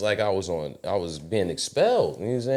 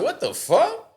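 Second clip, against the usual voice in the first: shocked disbelief; slower than usual. Retold or imitated. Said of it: "I was like".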